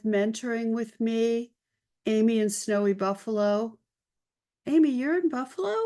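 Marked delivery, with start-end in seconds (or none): 4.7-5.7 surprised. Real, not acted. Greeting viewer, surprised at location.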